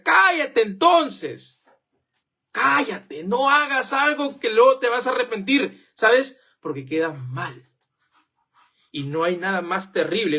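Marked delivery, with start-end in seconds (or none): 0.0-5.1 slow, higher, emphatic shut up; stern rebuke. Acted. Preacher scolding someone who made empty promises, slow and stern. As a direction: drag out the rebuke.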